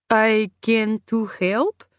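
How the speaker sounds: put-on slow, halting Polish accent; unsure worker, thick Polish accent; heavy Polish accent. A narrator's reading. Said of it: "in a heavy Polish accent".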